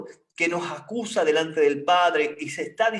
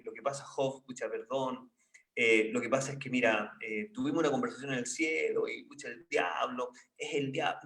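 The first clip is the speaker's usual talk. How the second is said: gentle aside voice; 5 semitones lower, 6 dB quieter than usual. Pretend. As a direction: lower and softer, as God confiding to Job. God voiced explaining to Job.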